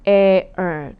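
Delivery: 에얼 said as one loud blended sound. Shown as a demonstration. Teacher modelling the air sound for the class. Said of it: "에이얼 이렇게 되거든요".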